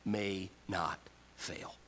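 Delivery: slow hushed whisper. Acted. Jesus promising Simon his faith will hold, whispered. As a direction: breathe the words out in a whisper.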